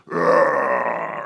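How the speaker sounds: loud mock monster roar. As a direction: roar like a giant marshmallow monster. Marshmallow monster climbing building.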